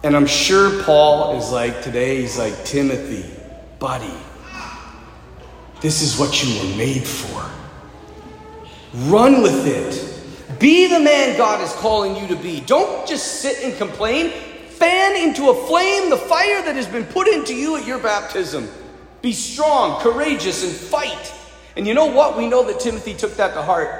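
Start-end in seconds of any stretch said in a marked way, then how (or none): 2.6-7.4 low, softer, slowed-down counsel; steady him like a mentor; fatherly reassurance. Retold or imitated. Voices Paul counselling discouraged Timothy. 9.1-21.3 higher, urgent pep talk; rally a discouraged young friend; rousing exhortation. Retold or imitated. Voices Paul rousing Timothy to fight.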